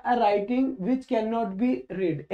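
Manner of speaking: each word spelled out very slowly; slow dictation. Shown as a demonstration. Teacher dictating a definition to students, very slow and deliberate. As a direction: read it slowly so students can write it down.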